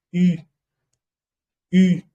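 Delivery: U said as a rounded French /y/, lips forward; teacher modelling French U for students; French u sound. Shown as a demonstration. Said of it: "Pueden ver cómo estoy pronunciando la U".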